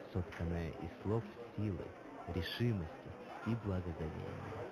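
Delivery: quiet reminiscing. Acted. Recording, much softer and lower.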